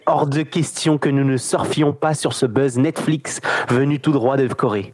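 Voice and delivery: ordinary talk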